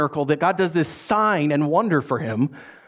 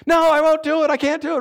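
high, louder, mulish protest; stubborn refusal; 11 semitones higher, 4 dB louder than usual. Retold or imitated. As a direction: dig your heels in like Peter. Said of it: "argues with Jesus three times".

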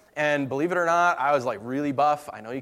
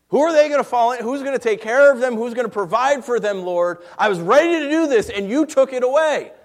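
louder and higher, pleading in anger; yelling at God; 7 semitones higher, 6 dB louder than usual. Retold or imitated. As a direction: cry out to the Lord in frustration. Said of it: "actually just yelling at God".

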